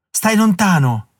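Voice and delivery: put-on a scared girl hiding in the woodshed shouts; frightened shout. A narrator's reading. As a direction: keep him away with a sudden yell. Hidden girl warns him off.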